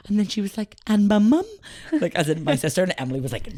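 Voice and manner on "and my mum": imitated toddler, high and loud; loud, high question from an accented toddler; little niece's accent. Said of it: "she's a funny little accent".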